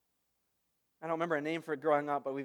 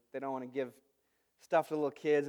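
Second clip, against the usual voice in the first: ordinary talk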